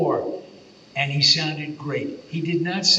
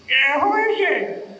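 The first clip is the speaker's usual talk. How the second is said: put-on high, louder, slow old man; 12 semitones higher, 6 dB louder, slower than usual. Actor still pranking the director, slow old man. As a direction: drag it out like a confused old man. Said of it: "and he said".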